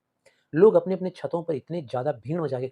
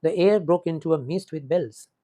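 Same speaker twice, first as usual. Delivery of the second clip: stretched, very slow, a bit louder; slow poem recitation; 2 semitones higher, 4 dB louder, much slower than usual. Shown as a demonstration. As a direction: read the verse slowly, with weight. Teacher recites the same line again. (धीमा, ज़ोरदार कविता पाठ)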